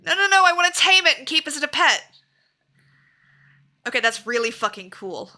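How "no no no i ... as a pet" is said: high, loud, pleading no; loud protest. Real, not acted. Streamer protesting the beast being killed, loud and pleading. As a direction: beg to keep the beast.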